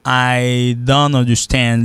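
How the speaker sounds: slow, drawn-out "I don't understand" without the T; silent T. Shown as a demonstration. Teacher showing the silent T, slow linked "I don't understand". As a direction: stretch "I don't understand", linking don't into understand. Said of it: "Le T ne se prononce pas".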